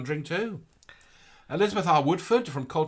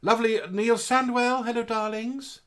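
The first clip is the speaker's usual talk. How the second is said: sing-song greeting; 10 semitones higher than usual. Acted. High greeting to viewer.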